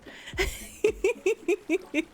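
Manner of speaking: giggling high and light; high laugh. Real, not acted. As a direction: let out an amused high laugh. Laughs facing the scene.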